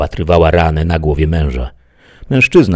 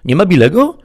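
high, louder questioning voice; surprised deputy; 14 semitones higher, 7 dB louder than usual. A narrator's reading. Deputy asks the woman at the desk, surprised. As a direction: ask it with raised pitch and volume.

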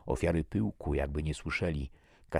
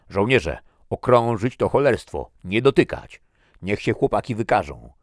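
loud, raised commanding voice; shouted order; 6 semitones higher, 12 dB louder than usual. A narrator's reading. Captain sends soldiers to surround the bud, shouting orders. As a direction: order the troops like a commanding officer.

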